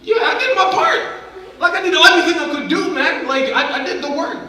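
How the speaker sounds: relieved triumph. Retold or imitated. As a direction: shout up to God with joyful relief. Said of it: "I'm like".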